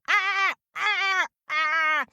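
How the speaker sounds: high, harsh squawking; angry crow squawk. A narrator's reading. Crow swoops on Tina overhead, angry squawk. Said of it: "she heard an angry".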